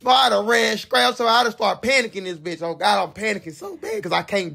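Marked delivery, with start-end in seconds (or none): none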